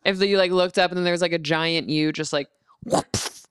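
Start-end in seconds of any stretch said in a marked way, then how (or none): 2.8-3.4 soft unvoiced whoops, fading away; whispered whoops. Giant chef whispering to himself over a dropped potato. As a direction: breathe the whoops out like a giant's little oops.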